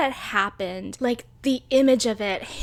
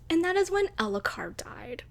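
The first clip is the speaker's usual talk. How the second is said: whisper; 6 semitones higher, 6 dB quieter than usual. Real, not acted. Reveals a tragic character death.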